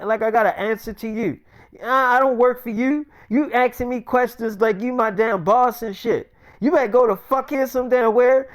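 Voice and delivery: acted touchy, aggrieved tirade; indignant scolding. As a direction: tell them off for questioning you. Retold scolding over being questioned.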